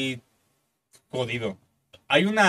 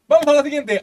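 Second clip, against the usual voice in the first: cheery host; 9 semitones higher, 7 dB louder than usual. Retold or imitated. Host cutting to next segment.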